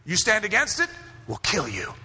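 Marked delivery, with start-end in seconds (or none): 1.3-1.9 low, quieter acted threat. Regime answering anyone who stands against it, low threat. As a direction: deliver the threat pitched way down.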